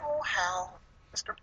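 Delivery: high, soft whiny villain voice; a pouty, simpering lament; lightly hammed villain whine; mock Hector Hammond. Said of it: "he's like".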